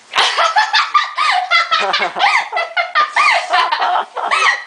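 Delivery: high hiccuping giggle. Real, not acted. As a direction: giggle in little hiccuping bursts. Laughing after failed attempt.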